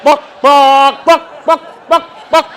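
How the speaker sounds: high, loud, building chicken clucking "Buck, buck". Retold or imitated. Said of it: "just going".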